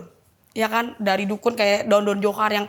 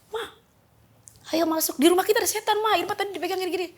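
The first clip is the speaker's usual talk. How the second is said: retold frightened cry; 8 semitones higher, faster than usual. Child running out to mother about a devil, retold high cry. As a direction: re-enact an alarmed call to mother in a raised pitch. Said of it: "Saya teriak-teriak lah keluar gitu".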